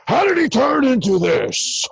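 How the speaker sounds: Pacino yell. Retold or imitated. Mimicking later Pacino, a big strained yell. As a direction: burst into a later-Pacino shout.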